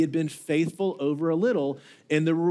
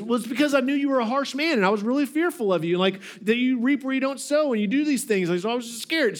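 higher-pitched, hurried self-justifying; mock fearful excuse; 7 semitones higher, faster than usual. Servant making up an excuse to his master, mock fearful. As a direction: plead innocence with put-on fear. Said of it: "he's making up an excuse".

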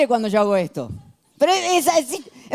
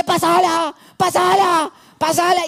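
high, urgent shout demanding the ball; teammate yelling pass it; 7 semitones higher, slower than usual. Retold or imitated. A pushy teammate yelling for the ball, high urgent shout. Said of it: "te dice".

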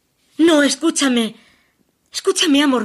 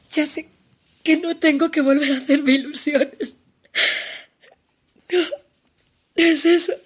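crying; 3 semitones higher than usual. Acted. Lover breaking down on phone.

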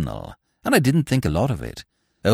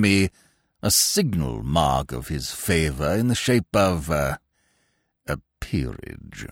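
put-on pompous old lord; 11 semitones lower, 3 dB quieter than usual. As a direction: speak down from great dignity, deep and measured. Pompous peer speaks.

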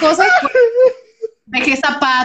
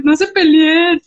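mock crying plea, drawn out and high; llanto imitado; 4 semitones higher, much slower than usual. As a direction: wail it as a hammed-up sob. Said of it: "llorando en mi cuarto". (lloriqueo fingido, alargado)

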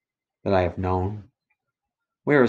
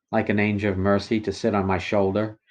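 usual speaking voice

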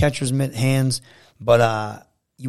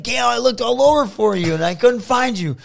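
higher and louder, hammed-up apology; mock pleading; 12 semitones higher, 4 dB louder than usual. Telling Gail he searched for her, higher hammed-up pleading. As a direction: play it up as an over-eager excuse.

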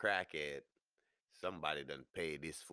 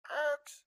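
tire screech; 10 dB louder than usual. Retold or imitated. Braking car noise.

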